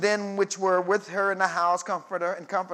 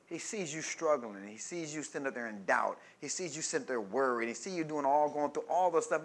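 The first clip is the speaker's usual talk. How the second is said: tender; 5 semitones lower, 7 dB quieter than usual. Real, not acted. Tender address to struggling believers.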